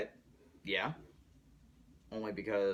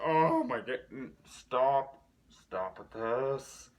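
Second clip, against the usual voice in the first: retold anguish; 3 semitones higher, 6 dB louder, much slower than usual. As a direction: beg the screen to stop, stretching it out. Begging the film to stop.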